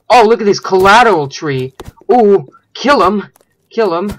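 loud, high excited shouting; excited attack. Pretend. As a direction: whoop and cheer on the attack. Excitedly attacks a tree in game.

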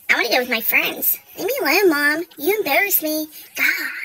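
low, surly mock teen; put-on teenage grumble; sulky teenager. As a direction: imitate an embarrassed kid, dropped low and loud. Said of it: "It's gonna be about".

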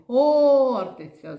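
ХОРТИЦЯ with a loud, high, stretched О; stressed sound called. Shown as a demonstration. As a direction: hold the stressed О of ХОРТИЦЯ and call it out. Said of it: "який звук тут наголошений".

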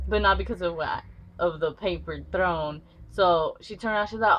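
ordinary talk

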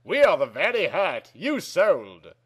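ordinary delivery

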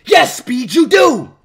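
loud, emphatic mimicked answer; mock viewer reply. Streamer imitating what every viewer says, mock emphatic. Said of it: "every single one of y'all says".

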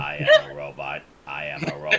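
glitchy robotic audio. Real, not acted. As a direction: sound like a broken robot. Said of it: "You turned into a robot".

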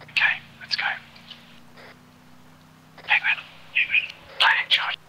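hushed radio call; whispering. Real, not acted. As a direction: whisper the callouts. Quiet radio call on approach.

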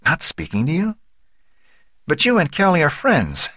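high, puzzled mother; baffled disbelief. A narrator's reading. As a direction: question it in worried disbelief. Mother puzzled by boy's news.

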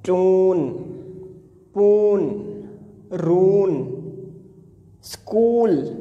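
"टून, पून, रून, स्कूल" each said loud with a long stretched ऊ; loud drawn-out oo sounds. Shown as a demonstration. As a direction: call out each word stretching the oo. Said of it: "ऊ की आवाज़ समझ आ जाएगी". (ऊ की लंबी आवाज़ ज़ोर से खींचकर)

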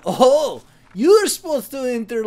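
sudden realization. Real, not acted. Inspector spotting a bad date, startled. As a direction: catch the mistake out loud.

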